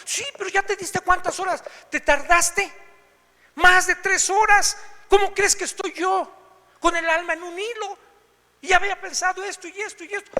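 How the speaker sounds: high, loud exasperated scolding; reproachful wife. Retold or imitated. Wife scolds husband for coming back three hours late. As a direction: let him have it for being hours late.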